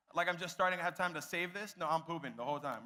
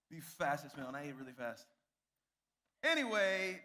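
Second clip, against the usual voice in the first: ordinary talk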